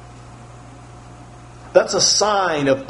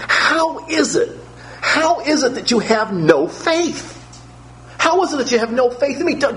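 higher, earnest reproach; Jesus rebuking gently; 6 semitones higher than usual. Acted. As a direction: ask why they have no faith. Preacher voices Jesus challenging faith.

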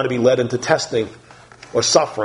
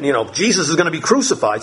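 usual speaking voice

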